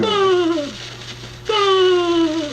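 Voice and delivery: high loud hammed-up baa; mock lamb bleat. Wolf in lambskin pretends to bleat, hammed-up high baa. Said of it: "You call that bleeding?".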